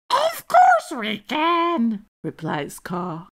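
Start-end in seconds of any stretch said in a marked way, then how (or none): 0.1-2.0 high, drawn-out bird squawk; screechy blue jay. A narrator's reading. Jay telling Elf birds can talk, screechy.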